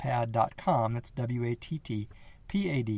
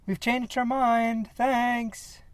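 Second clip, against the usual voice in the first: high, slow, strained forced politeness; tense call out; 12 semitones higher, much slower than usual. Acted. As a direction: call out, afraid but polite. Calls out, pepper spray ready.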